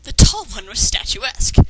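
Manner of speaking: normal talking voice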